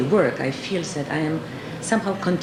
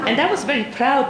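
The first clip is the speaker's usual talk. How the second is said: words bubbling through a real laugh, higher; laughing while speaking; 8 semitones higher, 4 dB louder than usual. Real, not acted. Speaker amused by her own classroom story, laughing. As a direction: chuckle out the line.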